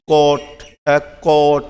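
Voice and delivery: কট with an open "aw" in the middle. Shown as a demonstration. Teacher shows learners the O in cot, careful pronunciation demo. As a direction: sound out কট with the vowel of অ. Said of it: "মাঝখানে একটা অর মতো উচ্চারণ হয়েছে".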